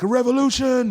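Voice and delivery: high, loud scream of revolt; screamed rallying cry. Retold or imitated. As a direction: scream it like a slogan to a crowd. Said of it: "I'm just screaming that shit out".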